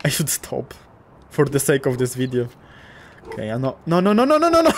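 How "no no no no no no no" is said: rapid pleading rising to a high, loud pitch; panicked no-no-no. Real, not acted. Player reacting to trouble in the game, rising panic. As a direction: climb higher and louder in playful panic. Alarm during play.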